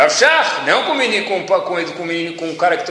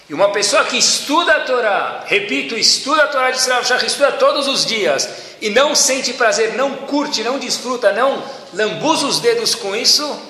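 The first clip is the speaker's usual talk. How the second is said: fervent preaching; 7 semitones higher than usual. Retold or imitated. Preaching Rav Shach's words.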